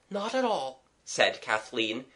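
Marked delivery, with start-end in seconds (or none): 0.1-0.8 lightly voiced girl, pitched up; reassuring girl. Kathleen reassuring the young man, gently.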